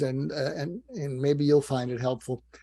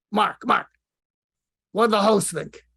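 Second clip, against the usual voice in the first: put-on loud, higher Brooklyn accent; Larry King; 6 semitones higher, 6 dB louder than usual. Dead mentor interrupts the confession, exasperated Brooklyn accent. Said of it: "in his Brooklyn accent".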